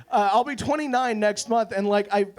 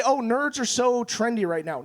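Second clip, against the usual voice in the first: light, quicker quote of a popular opinion; people's trend remark. Retold or imitated. People talking about nerds being trendy, casual quote. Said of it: "and people say".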